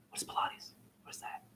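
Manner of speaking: hushed, voiceless whisper. Pretend. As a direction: whisper it like a baffled aside. Baffled aside, repeating the question under breath.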